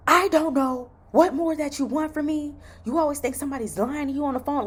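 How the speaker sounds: high, loud imitation of an angry recruiter; mock yelling. Nurse imitating her recruiter going off on the phone, mock yell. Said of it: "him yelling at me".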